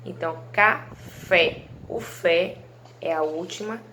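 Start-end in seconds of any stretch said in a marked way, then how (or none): 0.5-1.6 slow, split "café" with a louder "fé"; stress on final syllable. Shown as a demonstration. Teacher recapping for pupils, "café" broken up and stressed on "fé". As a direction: separate "café" as ca-fé, hitting "fé". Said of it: "A sílaba que vocês falam com mais intensidade".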